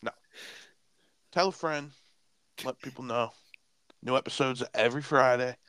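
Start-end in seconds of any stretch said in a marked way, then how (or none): 0.0-1.9 high, slow pleading; mock begging. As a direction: beg the fans, hammed-up.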